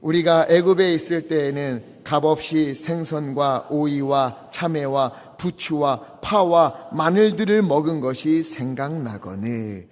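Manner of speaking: slow, loud, grumbling reading; complaining Israelites. Acted. Congregation reading as hungry Israelites, slow loud lament. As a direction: read it as a longing, grumbling crowd. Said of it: "이스라엘 백성이다 생각하고 한번 읽어보겠습니다".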